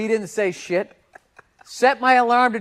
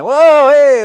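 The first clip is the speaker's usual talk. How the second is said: mock alarmed shout; 7 semitones higher, 13 dB louder than usual. Acting drunk driver near bus.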